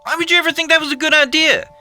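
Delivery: high, loud hysterical rant; hysterical yelling. A narrator's reading. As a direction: yell it in a hysterical fit. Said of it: "wound up into hysterics".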